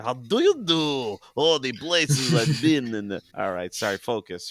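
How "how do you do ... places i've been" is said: loud, higher, drawn-out cartoon voice; Charlie Dog. Host quoting a cartoon short, hammed-up dog voice. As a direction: ham it up slowly like a boastful cartoon dog. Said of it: "the other Charlie Dog short".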